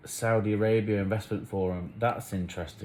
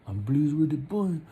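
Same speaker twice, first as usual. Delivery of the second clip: "I'm pleased with the point" said higher in a street accent, "point" like "boi"; put-on street accent; 7 semitones higher than usual. Shown as a demonstration. Rephrasing his point to the camera in a put-on street accent. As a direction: rephrase it in a playful slangy accent. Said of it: "let me rephrase that".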